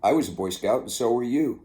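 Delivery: ordinary delivery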